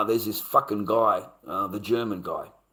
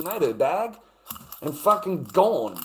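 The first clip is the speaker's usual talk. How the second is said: normal talking voice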